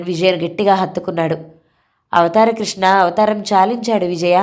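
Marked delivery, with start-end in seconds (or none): none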